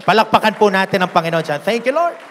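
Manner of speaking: loud, high call to clap for the Lord; rally the church to applaud; a preacher's loud shout of thanks; loud praise call. Real, not acted. Pastor calls for applause.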